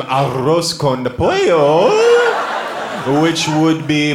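put-on drawn-out Spanish accent on the dish; hammed-up Spanish accent. Comic tempting the crowd with dishes, showy Spanish accent.

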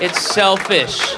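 stretched-out slow delivery; slow, drawn-out repeat. Acted. Comic slowly repeats the punchline to the crowd. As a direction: drag the word out, slowly.

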